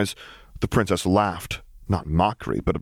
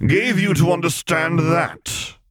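indignant senator bellowing; 10 semitones higher, slower than usual. A narrator's reading. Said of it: "Falia bellowed".

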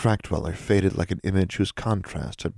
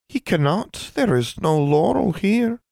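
high, slow little creature voice; tiny elf voice; 14 semitones higher, 3 dB louder, slower than usual. Acted. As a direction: squeak it from inside a rock. Small elf from a crack.

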